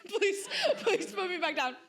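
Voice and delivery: high, laughing mock begging; laughing plea. Pleading to be put down, laughing. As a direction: beg through giggles to be put down.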